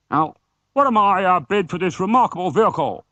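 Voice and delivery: high, loud auctioneer call; rally the room for bids; auctioneer's shout. Acted. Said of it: "shouted the auctioneer".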